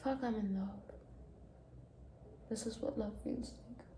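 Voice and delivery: breathless whispered swoon. Real, not acted. Reader swooning over a line, whispering. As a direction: whisper it, lovestruck.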